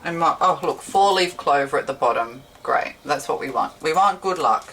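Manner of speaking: ordinary talk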